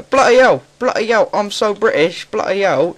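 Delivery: loud, high exaggerated British accent; mock British. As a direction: squawk it like a cartoon Brit. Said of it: "the slight difference in my voice".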